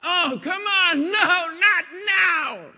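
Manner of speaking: high, loud mock exasperated yell; yelling at rain. Someone yelling at the rain, loud and high exasperation. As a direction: shout up at the sky in fake frustration.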